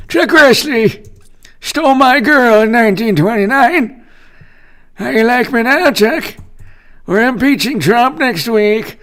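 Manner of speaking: high, strained senator caricature; Patrick Leahy. Acted. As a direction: crow in a hammed-up high senator voice. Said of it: "Vermont Senator".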